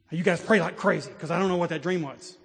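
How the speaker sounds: urgent command. Retold or imitated. Urgent order to pray, retold.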